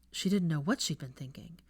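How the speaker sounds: ordinary delivery, nothing marked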